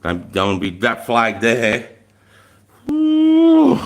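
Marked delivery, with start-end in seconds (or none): 2.9-3.8 long, loud high "ooh"; sustained falsetto-high whoop; high hoot. Real, not acted. High loud hoot between remarks.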